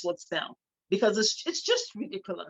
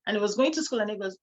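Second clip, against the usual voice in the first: ordinary talk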